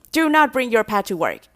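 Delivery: loud separated "Do not", stress on NOT; emphatic "Do not". Shown as a demonstration. Boss warning staff, loud stress on not. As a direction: split it into "Do not" and hit "not" hard. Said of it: "也是在强调".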